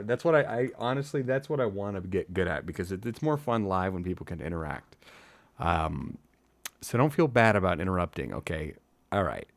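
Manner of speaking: usual speaking voice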